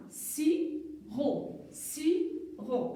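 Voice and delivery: "sirop" slowly split in two; syllable by syllable. Shown as a demonstration. Teacher demonstrating two syllables, "sirop" said split and slow. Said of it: "deux syllabes".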